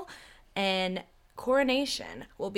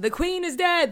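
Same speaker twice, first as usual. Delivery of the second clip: higher, louder mock proclamation; dramatic announcement; 10 semitones higher, 7 dB louder than usual. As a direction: cry it out for all to hear. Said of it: "this is what I'm opening with".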